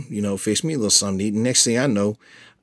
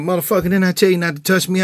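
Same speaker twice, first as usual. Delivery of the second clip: higher, louder, fast furious yell; angry yell; 10 semitones higher, 3 dB louder, faster than usual. Retold or imitated. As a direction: snap it at him like a drunk. Girlfriend yells after hitting him.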